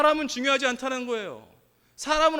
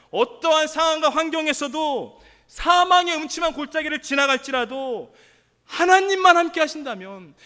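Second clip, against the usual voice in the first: preaching at full force; 2 semitones higher, 6 dB louder than usual. Real, not acted. Preacher promises victory when God is with us, preaching forcefully. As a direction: drive the promise home to the congregation.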